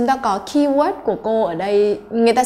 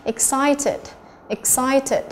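"Excited" stressed on CI, pitched higher; second-syllable stress; 4 semitones higher, much slower than usual. Shown as a demonstration. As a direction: punch the middle of "Excited". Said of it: "trọng âm thứ hai".

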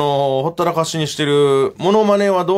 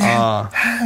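breath escaping; 4 semitones lower, slower than usual. Shown as a demonstration. Comedian shows how Sakana-kun's endings go, breath escaping. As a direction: lower demo with air let out at the end. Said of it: "息が抜けるようなさ".

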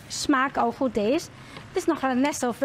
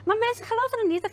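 ordinary talk, nothing marked

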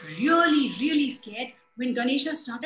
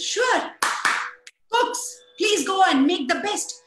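calling out; 7 semitones higher, 5 dB louder, slower than usual. A narrator's reading. Kubera calls to his cooks.

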